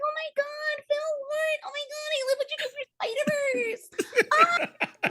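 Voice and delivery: high, fast, laughing and near tears; excited squeal. Retold or imitated. Fan gushing at the director in disbelief, high and laughing. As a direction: gush like a starstruck fan. Fangirling at Phil Lord.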